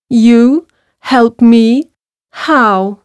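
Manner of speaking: slow, mildly incredulous lion. A narrator's reading. Trapped lion to mouse, slow doubtful voice. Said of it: "replied the lion".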